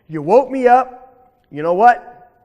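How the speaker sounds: loud, drawn-out accusation; menacing bully. Acted. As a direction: confront the rabbit like a cross alligator. Alligator confronts rabbit who woke him.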